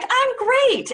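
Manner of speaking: high chirpy fake brightness; mock cheerful. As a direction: sing it like everything's fine. Quoting a falsely cheerful person.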